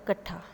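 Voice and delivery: कट्ठा with the first i dropped; Khari Boli form. Shown as a demonstration. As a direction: clip इकट्ठा to कट्ठा. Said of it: "इकट्ठा को क्या बोला जाता है". (खड़ी बोली में कट्ठा)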